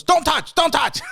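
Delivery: high, loud mock shout in sharp repeats; imitated screaming. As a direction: scream a frantic warning, hammed up.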